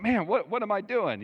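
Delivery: high, loud mock disbelief at himself; mock self-bewilderment. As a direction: exclaim it as if catching yourself, high-pitched. Mimics himself caught watching cartoons.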